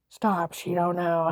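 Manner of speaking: slow, stretched mocking quote; drawn-out mimicry. Mimicking the agent who laughed at her, drawn-out mockery. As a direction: drag the words out slowly.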